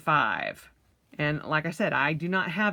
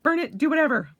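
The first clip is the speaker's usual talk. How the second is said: much higher, gushing and giddy; giddy high-pitched brush-off of the pink; gleeful relief; 11 semitones higher, 4 dB louder than usual. Retold or imitated. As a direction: cheer that someone else got the pink. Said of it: "And I'm like".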